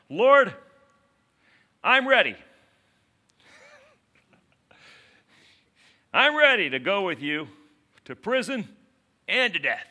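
high, loud hammed-up pledge; bold declaration. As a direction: declare it boldly, in loud bursts with big pauses. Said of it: "Peter boldly declares".

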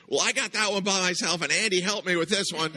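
put-on high, loud kid's boast; proud little kid. Small boy proudly confessing to the neighbor, high and loud. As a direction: own up proudly like a child.

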